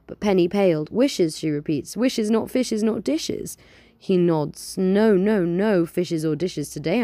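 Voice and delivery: lively storytelling read-aloud; story narration. Acted. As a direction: tell it like a bedtime tale. Reads her own story aloud.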